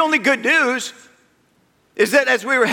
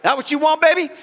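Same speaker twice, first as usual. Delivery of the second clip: mock taunt; 6 semitones higher, faster than usual. Taunting rafting guide in story.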